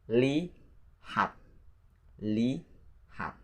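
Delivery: lihat split into li... hat; pronunciation practice. Shown as a demonstration. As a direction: say lihat slowly in two pieces. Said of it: "let's practice the pronunciation".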